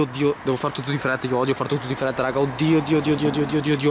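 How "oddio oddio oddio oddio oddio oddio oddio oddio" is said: panicked. Real, not acted. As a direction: panicky repeated oh god. Rushing down, panicking.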